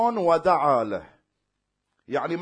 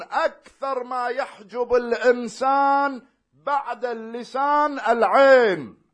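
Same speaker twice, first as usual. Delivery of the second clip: higher, louder slow exhortation; preaching at full force; 6 semitones higher, 7 dB louder, slower than usual. Acted. Preacher urging believers to guard their eyes, fervent preaching.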